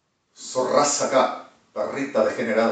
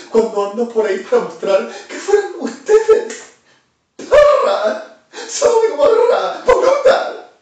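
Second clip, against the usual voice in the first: builds from raised voice to screaming far higher and louder; building furious rant; 9 dB louder than usual. Real, not acted. Ranting at enemies, building to a shriek. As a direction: rant that climbs into shrieking insults.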